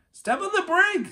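high, loud panicked command; urgent alarmed shout. A narrator's reading. Jack urging Annie as the buggy rolls back, panicked shout. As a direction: shout it in a hurry.

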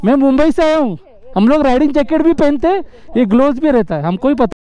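high-pitched, agitated pleading with the officer; heated protest. Real, not acted. Arguing with a traffic officer. (ऊँची आवाज़ में झुंझलाकर अफ़सर से बहस)

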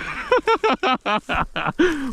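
high laughing burst; amused laugh; burst of laughter. Real, not acted. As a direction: laugh it off after the stammer. Laughing at the stammer.

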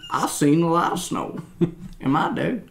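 lightly voiced old-timer; a hint of a grizzled old man; old-timer character. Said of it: "that's a new character".

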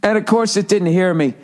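ordinary talk

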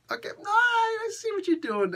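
squeaky high-pitched knowing voice. Pretend. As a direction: go way up, teasing. High put-on voice reacting.

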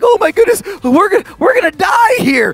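high-pitched mock panic; nervous and scared. Disciples caught in the storm, acted panic. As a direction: panic like the boat is going under. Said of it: "they're all nervous and scared".

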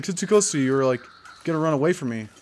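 usual speaking voice

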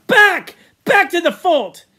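barked order, high and loud; commanding shout. Acted. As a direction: call the tribe back sharply. Chief commands the tribe.

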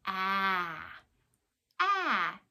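"ah" as an open short a, mouth wide; short a sound. Shown as a demonstration. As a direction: say "ah" as in apple. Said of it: "The first sound A makes is".

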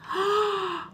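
high, quick gasp; sharp storyteller's intake of breath; excited gasp. Acted. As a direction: gasp in pretend delight. Storyteller reacts.

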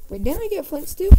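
high, loud startled question; excited sudden remembering; sudden realization. Real, not acted. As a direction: burst out as it hits you.

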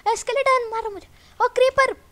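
high, loud startled yelling; alarmed shout. Real, not acted. Gamer surprised by skeleton and creeper, alarmed shout. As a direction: cry out in sudden panic.

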